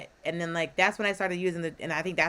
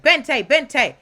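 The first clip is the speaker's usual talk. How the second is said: loud, raised-pitch calling in Spanish; imitated dog call; 5 semitones higher, 9 dB louder than usual. Owner calling his dog over in Spanish, imitated loud call.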